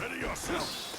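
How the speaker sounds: battle challenge. Acted. Challenge before duel.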